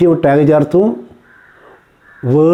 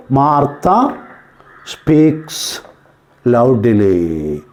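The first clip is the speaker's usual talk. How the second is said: slow, separated words with pauses; slow teacher dictation, last word drawn out low; 5 semitones lower, much slower than usual. Shown as a demonstration. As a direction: dictate each word for pupils to write.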